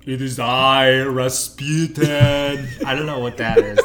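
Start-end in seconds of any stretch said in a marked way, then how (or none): 0.1-2.7 loud, drawn-out mock Rasputin; a strange, slow, hammed-up mystic; weird Rasputin voice. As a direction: stretch Rasputin out slowly, hammed up. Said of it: "That's something weird".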